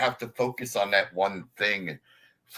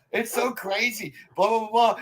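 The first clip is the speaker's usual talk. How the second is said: mocking frazzled coworker; 11 semitones higher than usual. Mimicking non-ADD people in a panic, high whine. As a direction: imitate a stressed coworker losing it. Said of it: "non-A D D people".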